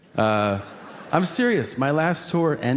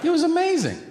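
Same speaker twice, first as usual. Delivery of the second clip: excited; faster than usual. Real, not acted. Recalls the animal sanctuary.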